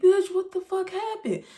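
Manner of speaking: high, loud retold cry of disbelief; a half-playful imitation of her own shock; alarmed outburst. To her friend, imitating her own shocked, loud question.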